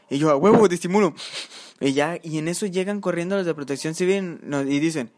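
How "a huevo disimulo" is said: loud, emphatic mock-confident reply; cocky agreement. Teller replays his reply to his friend, cocky. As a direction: toss it back loudly, full of bravado.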